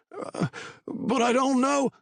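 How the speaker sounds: high, slow helpless voice; frightened whimper. A narrator's reading. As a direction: plead weakly in fear. Patty helpless.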